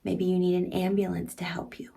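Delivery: ordinary delivery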